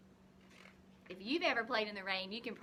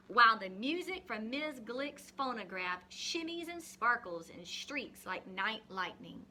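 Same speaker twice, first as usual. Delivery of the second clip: put-on reading a picture book to children; story reading; 3 semitones higher than usual. Teacher reading a rain story to the class, expressive reading.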